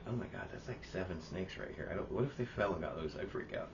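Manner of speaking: retold worry under the breath; nervous hush. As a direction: mutter it uneasily. Own nervous reaction near the snakes retold.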